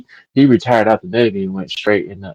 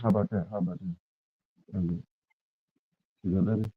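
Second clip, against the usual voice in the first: muffled; 3 dB quieter than usual. Real, not acted. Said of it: "you sound muffled".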